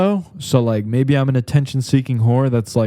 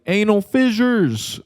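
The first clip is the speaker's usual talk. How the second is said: mock yell, high-pitched and drawn out; high, slow mock shout; retold heckle; 9 semitones higher, slower than usual. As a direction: imitate calling out from the crowd without real volume. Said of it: "both times I yelled shit out".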